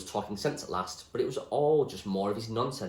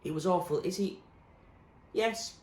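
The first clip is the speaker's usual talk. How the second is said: lightly raised character voices; voiced characters; 8 semitones higher than usual. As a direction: act out the children's dialogue. A narrator voices the figures.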